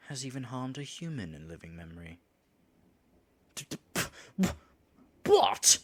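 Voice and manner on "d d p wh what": stuttering, then a loud, very high what; shocked stammer. Acted. Told the dragon is to die, stammering loud shock. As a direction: trip over the words, then burst out in disbelief.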